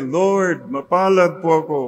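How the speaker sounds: bright smiling thanks to the Lord; with a smile. Shown as a demonstration. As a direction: let the smile come through the words. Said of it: "Say it with a smile".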